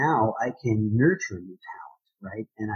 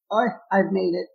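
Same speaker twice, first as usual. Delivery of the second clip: mock full-of-himself industry guy, pitched higher; higher, lightly mocking big-shot voice; smug insider; 8 semitones higher, 3 dB louder than usual. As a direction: play a smug know-it-all who thinks he's made it. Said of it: "that guy that says".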